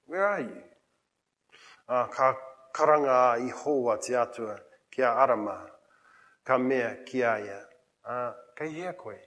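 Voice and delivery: softly spoken, tender question; gentle. Retold or imitated. Preacher voicing God's question to those in the garden, gently. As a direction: ask it very gently, hushed. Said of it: "very gently asks".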